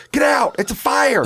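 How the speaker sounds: high-pitched mock fire alarm yell; retold panicked scream. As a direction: re-enact screaming a fire warning down the hall. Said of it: "I start screaming from the upstairs hall".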